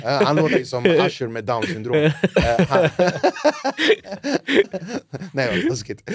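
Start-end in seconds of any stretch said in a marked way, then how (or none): 2.9-5.3 high, helpless burst of laughter; cracking up at his own joke; loud laughter. Real, not acted. Host laughing at his own mean joke, loud helpless laughter.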